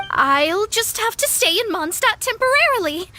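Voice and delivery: ordinary delivery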